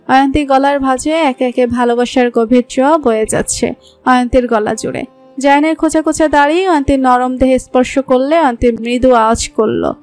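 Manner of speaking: ordinary delivery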